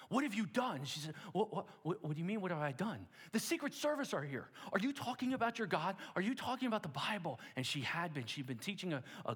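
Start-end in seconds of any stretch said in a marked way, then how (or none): 0.1-0.8 alarmed demand. Acted. Superintendent confronting Sylvia. 1.3-7.4 flustered protest. Acted. Sylvia confused by the accusation.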